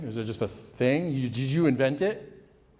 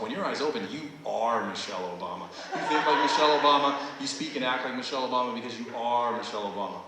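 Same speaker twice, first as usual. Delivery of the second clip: hypnotist suggestion; 3 semitones lower, faster than usual. Acted. Hypnotist gives trance suggestion.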